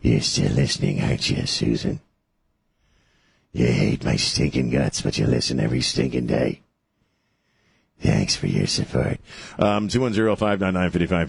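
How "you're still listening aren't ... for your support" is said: creepy voice. Acted. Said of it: "using my creepy voice".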